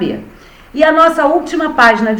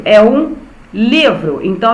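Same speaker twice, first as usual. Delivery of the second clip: ordinary talk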